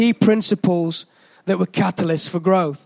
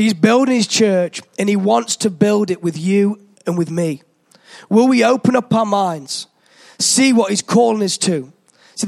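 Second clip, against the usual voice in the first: ordinary delivery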